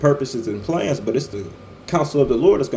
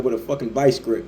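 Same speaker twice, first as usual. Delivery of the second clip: ordinary talk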